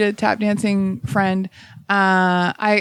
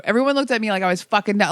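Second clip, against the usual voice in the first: ordinary talk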